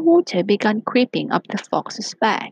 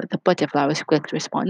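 plain reading voice

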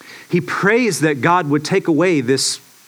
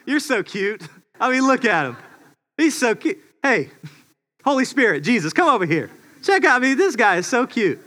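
a higher, tender cooing voice; doting God; 7 semitones higher than usual. Pretend. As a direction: coo over the little one fondly. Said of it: "And God's going".

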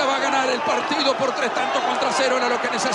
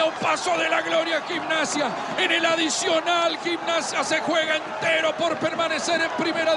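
excited play-by-play; 4 semitones higher than usual. Acted. Replayed first goal commentary.

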